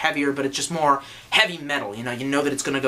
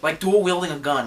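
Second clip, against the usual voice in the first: usual speaking voice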